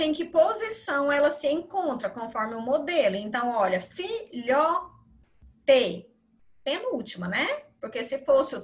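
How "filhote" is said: "filhote" broken into fi, lho, te; separado em sílabas. Shown as a demonstration. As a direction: pause between each syllable of "filhote". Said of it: "você vai separar em sílabas". ("filhote" dito devagar, sílaba por sílaba)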